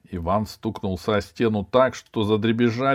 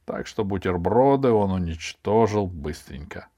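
plain reading voice

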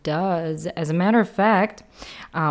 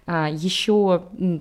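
ordinary talk, nothing marked

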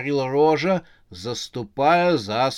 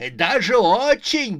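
loud, high outburst; indignant baron; 9 semitones higher, 5 dB louder, slower than usual. Acted. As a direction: burst out in outrage, stretching the words. Angry baron cuts in. (возмущённый выкрик барона)

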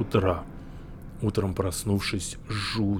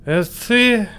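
put-on high, loud drunken drawl; town drunk; 13 semitones higher, 7 dB louder, slower than usual. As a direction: drag the words out tipsy. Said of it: "протянул я пьяным голосом".

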